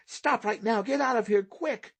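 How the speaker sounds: high, rising panicked shout of an inner voice; a frightened small voice yelling to flee; terrified little voice shouting. A narrator's reading. Inner voice urging Paul to flee, high panicked shout. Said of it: "A terrified little voice shouted".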